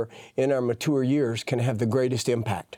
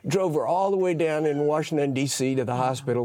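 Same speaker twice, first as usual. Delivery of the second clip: usual speaking voice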